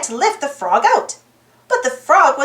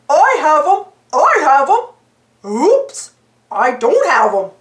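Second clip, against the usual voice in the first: excited cartoon-dog yelps turning to dismay; goofy sidekick fumbling a slippery frog; yelping; 3 dB louder than usual. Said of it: "Goofy yelped".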